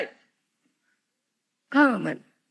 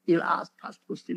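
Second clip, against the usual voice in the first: usual speaking voice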